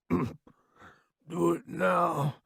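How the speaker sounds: very slow, choked gurgle; gurgling. A narrator's reading. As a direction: force the words out through blood. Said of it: "he gurgles".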